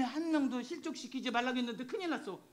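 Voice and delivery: ordinary talk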